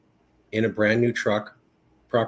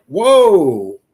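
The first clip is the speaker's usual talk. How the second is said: high, loud mock shock; a big startled whoa as Rogan; imitating Rogan's whoa; 5 semitones higher, 11 dB louder than usual. Podcaster reacting to the video, loud startled whoa. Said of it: "even he was like".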